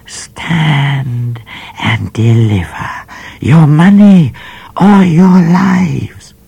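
high, put-on menacing call from the bush, fading to a whisper; a high disguised robber voice, ending whispered; bushranger's call. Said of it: "a voice called out of the bush".

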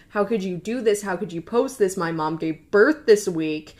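ordinary talk

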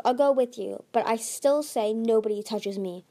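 ordinary delivery, nothing marked

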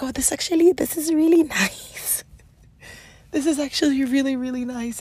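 high-pitched marvelling, drawing out nice; pleasantly surprised. Retold or imitated. Retelling her reaction to being supported, pleasantly surprised. Acts out delight at being cared for.

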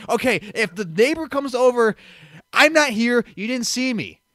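high, loud urgent orders; brief an accomplice in a panic, acting it out; replayed panicky insistence; retold frantic instructions. Acts frantic orders to the roommate.

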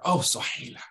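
breathy whispered endearment; tender whisper. Acted. Husband whispering tenderly to his wife after thirty years.

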